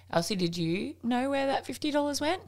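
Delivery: gently fishing for a confession; probing mum. Retold or imitated. Mum asks her daughter about the missing money.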